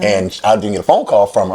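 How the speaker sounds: ordinary talk, nothing marked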